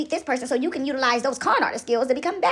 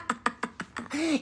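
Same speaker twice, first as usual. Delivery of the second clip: softer trailing cackle; taunting laugh; 9 dB quieter than usual. Acted. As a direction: let the gloating laugh die away.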